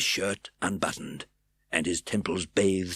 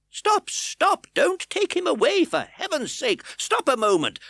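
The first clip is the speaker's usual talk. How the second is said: very high, loud urgent cry; breathless cry; 6 dB louder than usual. A narrator's reading. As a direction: burst in crying stop. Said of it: "cried the newcomer, breathless with haste".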